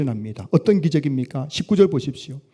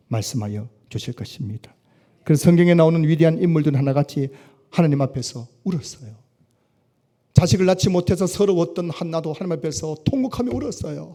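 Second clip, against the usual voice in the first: ordinary delivery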